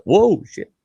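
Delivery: high startled yelp, retold. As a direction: re-enact being caught off guard by a visitor. Startled by visitor at the door.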